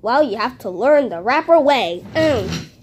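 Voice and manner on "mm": ordinary delivery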